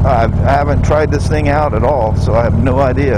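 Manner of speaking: talking loud over road and wind noise on a moving motorcycle. Real, not acted. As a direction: project the voice over the engine and wind. Talking while riding motorcycle in wind.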